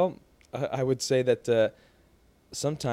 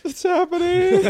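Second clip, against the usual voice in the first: high, slow, louder mock gasp of it's happening; mock movie line; 7 dB louder, slower than usual. Friend quoting a movie line, high and slow. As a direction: squeal it out like a movie moment.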